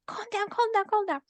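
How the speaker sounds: high, insistent doctor soothing; a friend urging calm; urgent calming. Retold or imitated. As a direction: repeat it fast to calm someone. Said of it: "she said".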